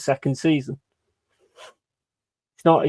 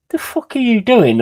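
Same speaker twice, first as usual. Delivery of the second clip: incredulous outburst; 9 semitones higher, 6 dB louder than usual. Retold or imitated. Retold reaction to a mate in the pub.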